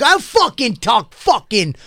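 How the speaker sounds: high-pitched, relentless mock cursing tirade; worked-up impression of a man ranting, pitched high; imitated enraged rant. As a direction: spit out the curses, imitating a furious stranger. Acting out stranger's cursing rant.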